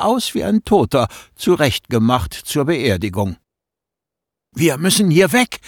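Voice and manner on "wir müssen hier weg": higher, pleading urgency; urgent plea. Acted. Boy urges his friend to flee, urgent plea. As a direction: beg your friend to leave now. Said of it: "beschwor ich meinen Freund".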